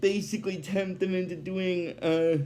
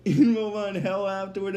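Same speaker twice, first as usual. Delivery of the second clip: ordinary talk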